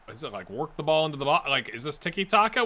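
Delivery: ordinary delivery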